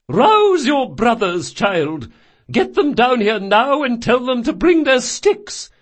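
high, loud, urgent command; shouted orders. Acted. Grandmother to granddaughter upstairs, loud high command. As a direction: order the household out at once.

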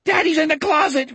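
put-on high, panicked child's cry; very high frightened scream without much volume. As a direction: cry out in terror for daddy. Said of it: "Richard screamed".

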